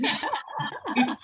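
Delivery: breaks into a high laugh. Real, not acted. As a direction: giggle out the word covid. Teasing reveal of their covid vacation.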